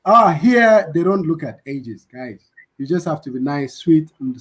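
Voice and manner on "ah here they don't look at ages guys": ordinary talk, nothing marked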